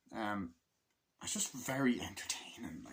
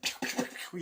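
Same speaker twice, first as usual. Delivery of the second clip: gunfire noises. Retold or imitated. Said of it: "and they're like".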